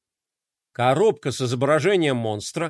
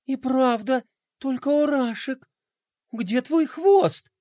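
sympathetic; 6 semitones higher than usual. A narrator's reading. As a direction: coo it gently to a sad friend. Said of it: "сочувственно спросила Пинки".

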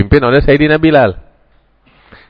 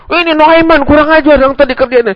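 indignant reply; 14 semitones higher than usual. Retold or imitated. Blind man justifies his beating.

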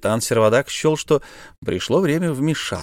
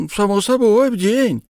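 very high, louder confirmation; brazen miser; 11 semitones higher, 4 dB louder than usual. Acted. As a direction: insist on it shamelessly. Miser confirms daily interest.

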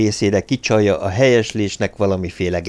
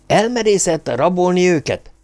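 high-pitched, indignant question; outraged; 9 semitones higher than usual. A narrator's reading. Hírek leaping up at news of the abduction, outraged. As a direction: pitch leaps up in disbelief.

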